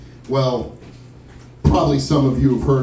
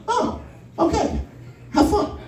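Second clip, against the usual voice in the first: high, breathy mock-mom voice, a little laugh in it; mocking mom's voice; 4 dB quieter than usual. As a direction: play mom brushing it off airily. Voicing mom in story.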